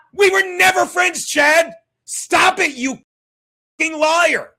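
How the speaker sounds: loud, high, furious denial; angry shout. Real, not acted. Shouting at Chad that they were never friends, furious. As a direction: yell it straight at him, enraged.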